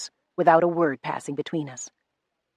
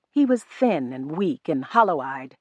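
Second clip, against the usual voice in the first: ordinary delivery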